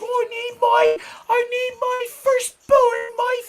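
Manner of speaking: put-on squeaky worried old mother, slow and pleading; mimicking his mom. Son imitating Sarge begging her firstborn home, high falsetto. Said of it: "She's like".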